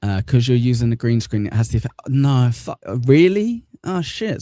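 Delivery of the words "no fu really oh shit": high, louder disbelief; real surprise. Real, not acted. Streamer finding out the green screen hides the hair, surprised. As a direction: pitch leaps up in dismayed realisation.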